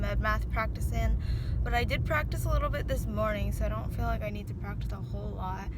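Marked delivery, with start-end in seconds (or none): none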